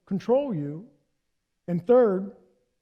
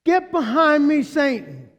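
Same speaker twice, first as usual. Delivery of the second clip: said loud and high, all together; loud group declaration; 5 semitones higher, 6 dB louder, slower than usual. Shown as a demonstration. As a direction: proclaim it out loud with the congregation. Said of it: "Let's say it together".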